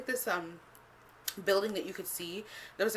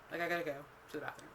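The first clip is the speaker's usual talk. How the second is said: fast, softer, tossed-off "I gotta go to the bathroom"; rushed mumble; 4 semitones lower, 8 dB quieter, much faster than usual. Retold or imitated. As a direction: rattle it off quickly and quietly. Repeating the excuse.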